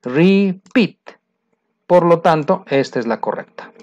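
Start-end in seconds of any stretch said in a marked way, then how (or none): 0.0-1.0 "Repeat" drawn out in two parts, Re-PEAT; stress on second syllable. Shown as a demonstration. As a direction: split "Repeat" slowly to show the accent on -peat. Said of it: "En la segunda, ¿sí o no?". ("Repeat" separado por sílabas, acento en la segunda)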